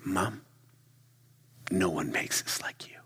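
soft, hushed, almost whispered thanks; half-whispered heartfelt thanks; son's hushed thanks. Retold or imitated. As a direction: hush it like a grateful son. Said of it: "He said".

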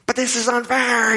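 high, whiny protest of unfairness; indignant protest. Retold or imitated. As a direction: pitch it way up, outraged. Angry customer arguing with clerk.

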